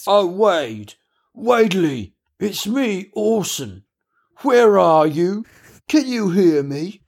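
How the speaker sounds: high, slow desperate calling. Acted. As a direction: call out across the house. Orson calling out for friend.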